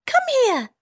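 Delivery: high squeaky mouse, quick and eager; town mouse voice. Acted. Town mouse inviting her friend to lunch, eager high squeak. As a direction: hurry your friend to the table.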